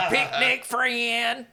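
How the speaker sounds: drawn-out creature speech to a friend; Belle's voice. Acted. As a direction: stretch out each simple word on a level pitch. Belle calls to Burgess.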